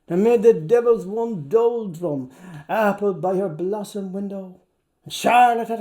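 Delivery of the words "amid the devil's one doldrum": plain reading voice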